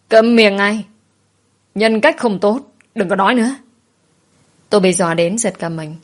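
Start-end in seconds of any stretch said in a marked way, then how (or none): 0.1-3.5 raised, higher scolding voice; angry. A narrator's reading. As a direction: cut them off with an angry order. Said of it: "bà lão giận dữ đập bàn".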